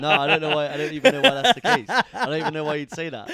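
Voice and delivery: real laughing denial, high and loud; can't keep straight face. Real, not acted. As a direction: protest loudly through laughter. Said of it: "Say it with a straight face".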